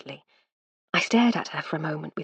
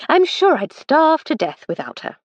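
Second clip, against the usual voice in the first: high, bright-pitched lady's voice, louder; Lady Hardcastle; 10 semitones higher, 6 dB louder than usual. Acted. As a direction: brush it aside as the lady of the house.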